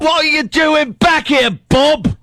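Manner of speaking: high, hammed-up English-accented holler; mock English accent. Co-host hailing the intern in a high mock-English shout.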